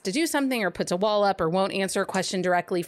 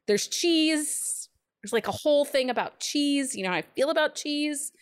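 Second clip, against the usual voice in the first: excited; 6 semitones higher than usual. Real, not acted. Excited about cheese.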